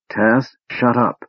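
through clenched teeth. A narrator's reading. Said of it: "through clenched teeth".

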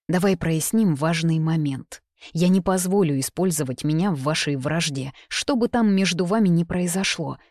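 ordinary delivery, nothing marked

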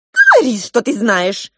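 demanding. Acted. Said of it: "потребовала розовая мышь".

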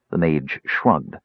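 usual speaking voice

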